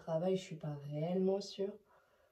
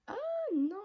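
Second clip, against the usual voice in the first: normal talking voice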